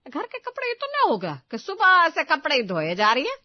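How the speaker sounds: loud, high angry scold. Acted. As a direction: scold her loudly for wasting the morning. Mother-in-law scolds about laundry.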